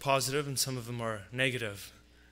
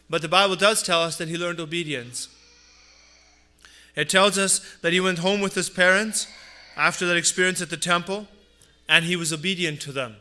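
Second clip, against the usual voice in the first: louder, rising pulpit voice; fervent preaching; 3 semitones higher, 5 dB louder than usual. Acted. Pastor preaching to his congregation about Jesus' family, fervent. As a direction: drive the point home from the pulpit.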